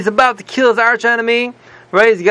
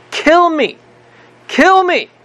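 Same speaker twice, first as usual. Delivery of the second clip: desperate plea; 9 semitones higher, slower than usual. Retold or imitated. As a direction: beg the king to kill him, voice leaping up in despair. Haman begs the king, desperate.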